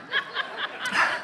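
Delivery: short breathy laugh at her own joke; amused laugh after an outdated reference; real laugh. Real, not acted. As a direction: let a real laugh slip out.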